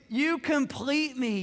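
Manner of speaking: slow, lifted, swooning quote; movie line. Retold or imitated. Pastor quoting Jerry Maguire to laughing congregation, slow swoon. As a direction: sigh it out like the film romance. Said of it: "second most famous line out of the movie".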